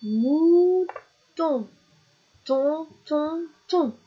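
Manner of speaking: high, held "mou", pause, "ton" then three repeats; syllable drill. Shown as a demonstration. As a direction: drill "ton" as the end of "Mouton". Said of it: "La dernière syllabe de mouton".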